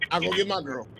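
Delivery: ordinary talk, nothing marked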